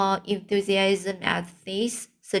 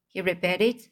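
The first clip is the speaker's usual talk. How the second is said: ordinary delivery, nothing marked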